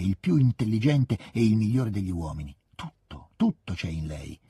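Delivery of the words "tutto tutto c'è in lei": imagined admiring man. A narrator's reading. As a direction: lower your voice as a clever man praising her. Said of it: "un uomo molto intelligente". (voce bassa di un uomo ammirato)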